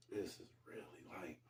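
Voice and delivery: hushed unvoiced whisper. Real, not acted. Telling viewers about the near miss, in a whisper. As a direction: drop to a whisper as if confiding.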